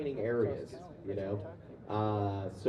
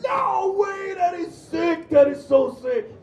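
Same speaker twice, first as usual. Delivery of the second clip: very high, loud imitated fan shout; excited accented stranger; 12 dB louder, slower than usual. Subway stranger hearing the actors' roles, ecstatic accented shouting. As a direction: starstruck New Yorker losing it. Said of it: "people with that strong of an accent".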